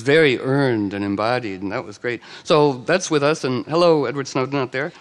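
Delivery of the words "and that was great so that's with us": normal talking voice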